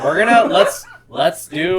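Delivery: laughing through the words. Real, not acted. Laughing after Fast X joke.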